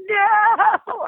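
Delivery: very high mock wail of no; mock heartbroken. Can't ride the parade float with her friend, mock wailing no. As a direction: whine it like a let-down kid. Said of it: "totally heartbroken".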